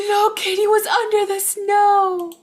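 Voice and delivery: gasping, high, loud discovery; excited discovery. Acted. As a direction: gasp then high and loud. Kitty found under snow.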